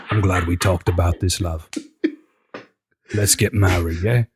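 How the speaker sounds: low, placid British sweet talk; hammed-up unbothered British lover; calmly affectionate, pitched low; chill boyfriend. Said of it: "they're both so chill".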